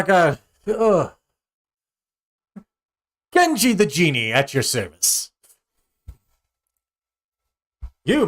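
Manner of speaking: high, loud genie voice with stretching groans. Acted. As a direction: stretch out, then announce your service grandly. Genie stretches, grants wish.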